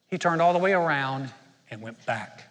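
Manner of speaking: normal talking voice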